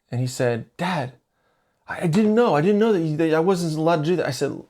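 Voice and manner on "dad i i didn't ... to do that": imitated flustered kid, higher-pitched; nervous son. Son excusing himself to dad, imitated nervousness. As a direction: stammer higher, pleading innocence like a child. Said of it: "You're nervous talking to me".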